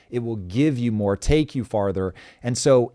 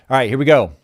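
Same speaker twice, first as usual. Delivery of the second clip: ordinary delivery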